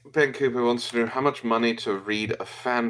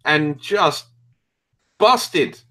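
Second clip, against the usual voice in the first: mock lament; 6 semitones higher, 9 dB louder than usual. Mock lament over corruption.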